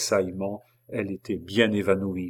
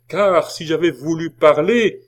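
high, loud, rising to a break-off; impassioned raised voice; threatening outcry; 8 semitones higher, 8 dB louder than usual. A narrator's reading. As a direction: shout it as a threat left hanging. Writhing prisoner shouts a threat.

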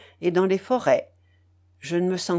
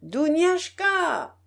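calling out; 9 semitones higher, much slower than usual. A narrator's reading. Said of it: "de toute sa gorge, elle appela".

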